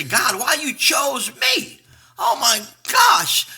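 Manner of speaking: loud, slow, high cry to heaven; crying out to God. Retold or imitated. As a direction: call up to God in loud disbelief.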